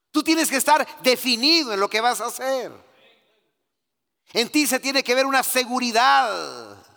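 high, loud fervent preaching; full-force preaching. Real, not acted. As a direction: preach it out to the congregation. (predicación a todo pulmón)

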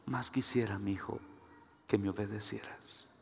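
low, hushed voice of God; tender, fading near-whisper to a son; murmured in the ear. Acted. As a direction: speak softly, as if into someone's ear. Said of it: "Dios susurra en mi oído".

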